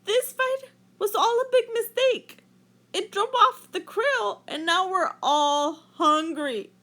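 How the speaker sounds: high, loud earnest plea; Rainbow Fish pleading. A narrator's reading. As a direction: explain it to the whale, high and sincere. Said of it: "said Rainbow Fish".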